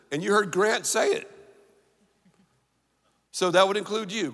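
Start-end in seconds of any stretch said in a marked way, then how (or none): none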